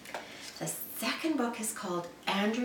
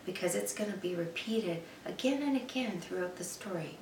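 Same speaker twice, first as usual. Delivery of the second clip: ordinary delivery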